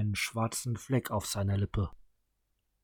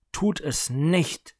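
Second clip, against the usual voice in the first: slow, higher, louder command; Anna demanding one last time, slow and raised; firm demand; 4 semitones higher, 4 dB louder, slower than usual. A narrator's reading. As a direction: spell out each word as a last order. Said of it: "verlangte Frau Anna".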